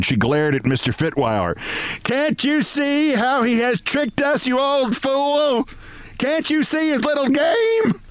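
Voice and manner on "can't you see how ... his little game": very high, drawn-out hysterical screech; hysterical bray. A narrator's reading. Furious woman to Mister Fitweiler, braying hysterically. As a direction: bray each word slowly at a high pitch. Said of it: "She brayed loudly and hysterically".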